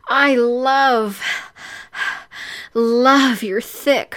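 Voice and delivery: high, breathy outburst with moans; aroused heroine. Acted. Heroine answering her lover's name-calling, high and panting. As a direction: pant and moan through the words.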